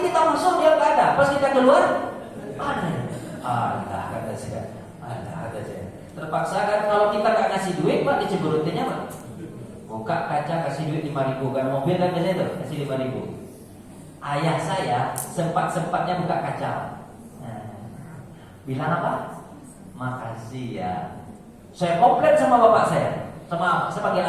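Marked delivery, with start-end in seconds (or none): 3.4-5.8 low, flat mock attendant voice; gruff reply. 20.0-20.9 soft, humble thanks; lightly voiced father, soft thanks; gentle thanks. Father thanks parking attendant.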